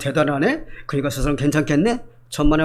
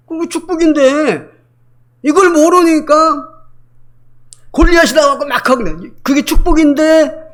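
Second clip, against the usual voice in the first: impassioned preaching; 9 semitones higher, 6 dB louder than usual. Real, not acted. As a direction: drive the point home to the congregation. Preacher pleading at full force.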